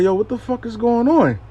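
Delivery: high-pitched, bewildered question; acted alarm. Reader asks client what's happening, acted alarm. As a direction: replay a startled demand to know.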